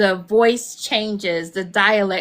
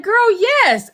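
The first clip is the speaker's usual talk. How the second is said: louder, high-pitched eager yes; emphatic approval; 9 semitones higher, 6 dB louder than usual. Retold or imitated. Friend approves the muumuu, emphatic approval. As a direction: imitate a friend giving an enthusiastic go-ahead.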